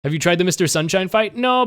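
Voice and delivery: usual speaking voice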